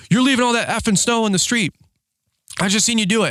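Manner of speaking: high-pitched accusing mock yell; retold angry yell. Irate man accuses plow driver, high imitated yell. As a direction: mimic him yelling his complaint, voice pushed high. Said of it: "yelling at me about plowing".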